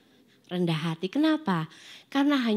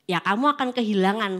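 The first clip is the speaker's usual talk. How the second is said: usual speaking voice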